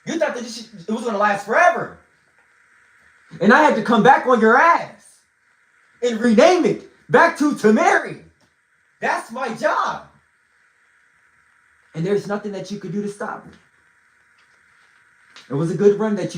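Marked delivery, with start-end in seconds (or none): 3.4-10.1 defiant proclamation. Real, not acted.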